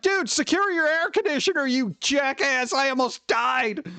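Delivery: mock angry scolding. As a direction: chew out the jerk who nearly killed you. Scolding careless appliance owner.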